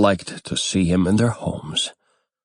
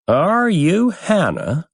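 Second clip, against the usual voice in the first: put-on old crone; 11 semitones higher than usual. A narrator's reading. Old hag approaches the stall.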